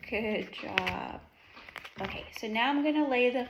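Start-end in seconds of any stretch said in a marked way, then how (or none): 0.1-1.2 put-on reading to a toddler. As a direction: coax a toddler patiently. Teacher quizzing toddler on animals.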